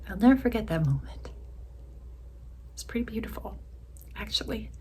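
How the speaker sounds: holding back tears. Real, not acted. Widow recalling the moment her husband said he was dying, tearful hush. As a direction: fight tears through a tender memory.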